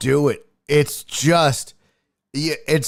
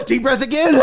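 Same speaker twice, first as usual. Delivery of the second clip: mock frantic cry; 10 semitones higher than usual. Patient panicking through the doctor's breathing instructions.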